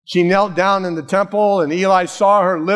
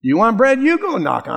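mock argument; 7 semitones higher than usual. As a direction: toss it back at your spouse. Couple arguing who goes.

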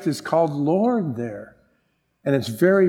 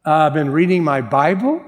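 reluctant, questioning rise at the end; hesitant. Retold or imitated. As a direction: try the admission out as a doubtful question. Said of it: "I'm thinking, "Am I gonna say".

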